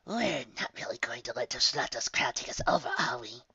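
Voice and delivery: hushed conspiratorial whisper. Acted. Soldier whispering to his commander. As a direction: whisper it so no one overhears. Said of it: "whispered question to commander".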